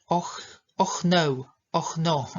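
"Och, och no" said slowly with a hard Polish "ch"; slowly mock the mistaken "och no"; wrong ch sound. Shown as a demonstration. Teacher imitating learners' mistake, slow wrong ch. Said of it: "osoby czytają to ch".

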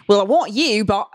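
Australian attempt slipping into Cockney; bad mock-Aussie accent; botched Australian accent. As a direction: try Crocodile Dundee and land in Cockney.

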